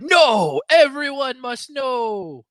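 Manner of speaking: high cry, slowing and stretched out; mock zealot's proclamation. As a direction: refuse grandly, as if to a crowd. Acting curate refusing private talk.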